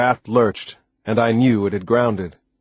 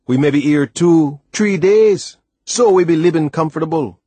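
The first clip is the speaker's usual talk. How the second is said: louder, higher island lilt; practical West Indian sailor; 6 semitones higher, 5 dB louder than usual. A narrator's reading. Sailor explains the camp to the boy, emphatic. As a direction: explain it emphatically in Caribbean dialect. Narrator voices Timothy.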